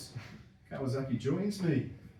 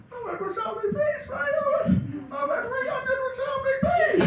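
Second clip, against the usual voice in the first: high, strained croaky voice through laughter; parched man laughing as he croaks. Retold or imitated. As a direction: croak it out with a bone-dry mouth. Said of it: "all I could do was just say".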